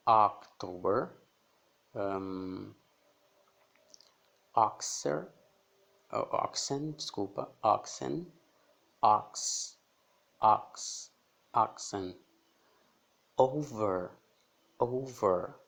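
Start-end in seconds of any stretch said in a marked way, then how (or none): 0.1-1.1 October, slow and loud, ending on a rise. Shown as a demonstration. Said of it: "som de O". 7.6-12.2 oxen with an open ah; oxen, ah-ksen. Shown as a demonstration. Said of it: "som de O". 13.4-15.5 over, round O. Shown as a demonstration. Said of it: "olha como mudou o som".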